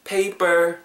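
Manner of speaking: "paper" slowly, puffed first p and tight second p; unaspirated second p. Shown as a demonstration. Teacher demonstrating "paper" after explaining it, tight middle p. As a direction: say "paper" as pay-bber. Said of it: "앞에는 P".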